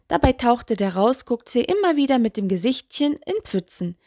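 ordinary delivery, nothing marked

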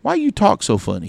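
high, puzzled kid imitation; twelve-year-old kid. Kid puzzled by a woman's twang, high mimicked voice. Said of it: "about twelve years old".

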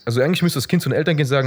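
ordinary talk

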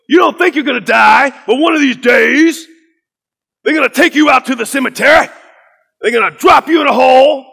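imitated pulpit shout, high and loud; Pastor Kevin Cosby. Pastor warning graduating students about death, shouted preacher imitation. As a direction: hammer each phrase like a fired-up preacher. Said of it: "start off a sermon".

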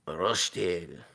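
low, soft put-on French accent; French accent sliding towards German; mock French accent. Shown as a demonstration. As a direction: savour it in a fake French accent. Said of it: "in the infamous podcast French accent".

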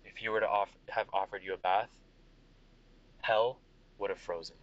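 low, weary general; tired soldier. Acted. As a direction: sigh through a long shift. GM plays tired Vega.

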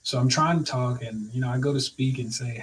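ordinary delivery

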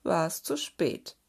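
ordinary delivery, nothing marked

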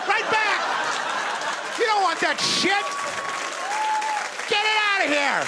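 high, emphatic shouts. Real, not acted. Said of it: "Hit it back".

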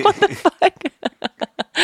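high, drawn-out squeal through laughter; helpless high-pitched laughing outburst; laughing retold disbelief. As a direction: crack up on a retold exclamation. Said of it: "I was like".

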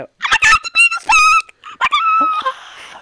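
high-pitched screaming panic, imitated; kids shrieking. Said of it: "these kids go".

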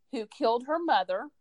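ordinary talk, nothing marked

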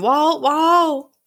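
high mock calling out; drawn-out, high, half-strength yell; retold scream. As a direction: imitate a father screaming for his son. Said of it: "Michael's screaming".

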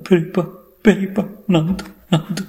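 high, broken cries; distraught wailing. Acted. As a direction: gasp it out between acted sobs. Wife weeping to elder uncle. (அழுகையில் உடைந்த உயர்ந்த குரல்)

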